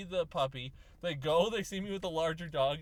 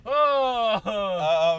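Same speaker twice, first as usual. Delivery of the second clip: loud dog; 3 semitones higher, 12 dB louder than usual. Retold or imitated. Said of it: "it's like".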